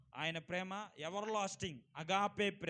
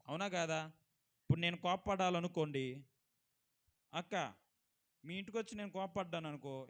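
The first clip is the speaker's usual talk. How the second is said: usual speaking voice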